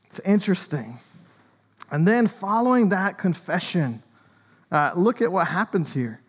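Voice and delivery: ordinary talk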